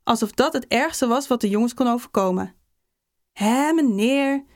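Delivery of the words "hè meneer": long drawn-out, higher schoolchild's plea; pleading. A narrator's reading. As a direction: beg the teacher, stretching the words. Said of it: "smeekte de hele klas". (smekend en langgerekt)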